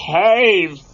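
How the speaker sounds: ordinary delivery, nothing marked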